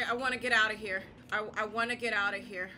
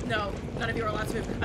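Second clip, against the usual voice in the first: ordinary talk, nothing marked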